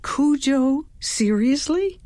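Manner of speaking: high, rising disbelief; incredulous question. A narrator's reading. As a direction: question it as if you can't believe it. Narrator incredulous at dog's name.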